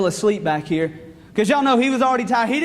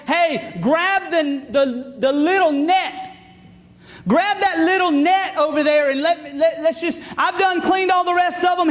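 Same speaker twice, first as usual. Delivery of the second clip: mock yelling; 5 semitones higher than usual. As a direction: call over to your buddies, pitched up. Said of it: "he yells at his buddies".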